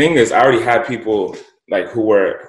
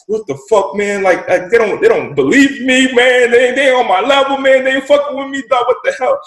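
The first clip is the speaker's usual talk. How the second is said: high, frustrated venting to himself; angry rant; 14 semitones higher, 4 dB louder than usual. Retold or imitated. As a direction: let it all out in the car. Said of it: "I'm so pissed off".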